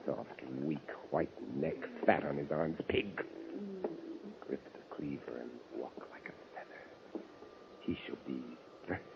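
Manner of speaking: low, very soft seething voice; murderous hush. Acted. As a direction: hate him in silence before striking. Stalking the lover to kill.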